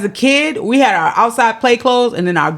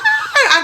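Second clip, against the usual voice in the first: high delighted ah; excited child gasp. Retold or imitated. Little girl hearing the bunny came, high gasp. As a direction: gasp like a thrilled kid.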